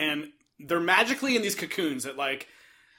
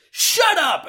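mock scream; 11 semitones higher, 4 dB louder than usual. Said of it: "she screams at her".

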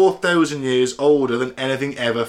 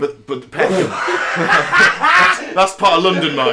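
bursting out laughing, high and loud; 5 semitones higher, 6 dB louder than usual. Real, not acted. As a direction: crack up mid-sentence. Cracking up over Peckham.

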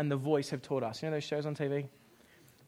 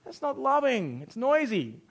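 joking blunt refusal; 6 semitones higher, 4 dB louder, much slower than usual. Retold or imitated. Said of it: "I'm like".

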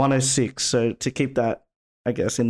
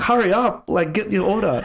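high-pitched mock impatience; retold anger; 8 semitones higher than usual. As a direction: hurry them along as an angry customer, hammed up. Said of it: "the guy's fucking angry".

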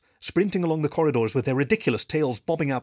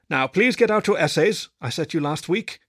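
louder teacher's command; 5 semitones higher, 4 dB louder than usual. A narrator's reading. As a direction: order the class to work. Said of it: "he commanded".

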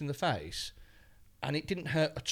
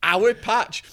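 "Our patch" mocked, loud and higher; mocking repeat; 7 semitones higher, 12 dB louder than usual. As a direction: sneer the words "Our patch" back loudly.

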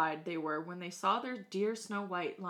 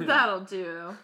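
loud queen; 10 dB louder than usual. Acted. Queen voiced in story.

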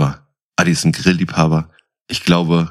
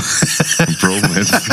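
amused repeat, laughing; laughing echo; 10 semitones higher, much slower than usual. Real, not acted. [name] laughing at the bromance remark. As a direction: repeat it and crack up.

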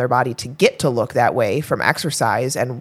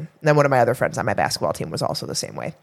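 ordinary talk, nothing marked

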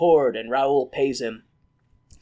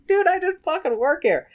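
mock exasperated bartender; 12 semitones higher than usual.

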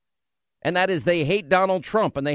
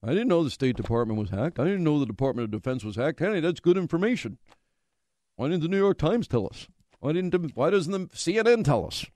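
mocking clueless people. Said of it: "how many people said".

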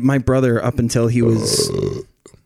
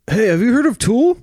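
high, bright excited stranger; eager fan voice; 11 semitones higher, 4 dB louder than usual. Retold or imitated. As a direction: play an overeager fan asking. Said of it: "People will be like".

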